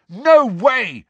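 high, loud outright refusal; boy's outraged yell. A narrator's reading. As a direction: yell it in defiance. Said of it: "yelled Harry".

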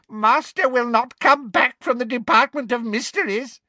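high, crowing elf voice; gleeful elf. Acted. Elf taunts Harry about his master, gleefully. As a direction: crow the bad news with glee. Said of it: "he said gleefully".

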